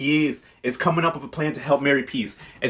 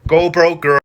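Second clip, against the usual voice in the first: much louder, punchy taunt; loud jeer; 3 semitones higher, 10 dB louder, slower than usual. Real, not acted. Recapper reacting to a bad money decision, loud jeering. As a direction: throw it out loud as a sassy dismissal.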